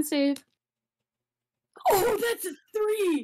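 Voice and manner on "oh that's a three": high, drawn-out cry of surprise; dismayed outburst. Real, not acted. Player seeing a terrible roll, high dismayed exclamation. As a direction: cry out at a disaster.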